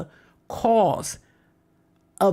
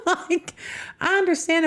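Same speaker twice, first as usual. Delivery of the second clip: laughing; 11 semitones higher than usual. Real, not acted. Laughing at own naive belief.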